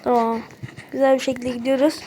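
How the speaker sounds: ordinary talk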